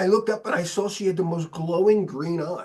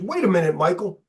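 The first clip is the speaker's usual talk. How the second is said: retold heated rant, climbing higher and louder; higher and louder with each question; mad outburst; 6 semitones higher, 4 dB louder than usual. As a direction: relive losing your temper at a defeatist friend. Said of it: "I just got mad and I said".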